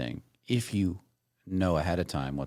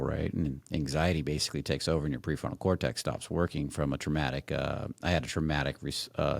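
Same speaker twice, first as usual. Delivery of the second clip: ordinary delivery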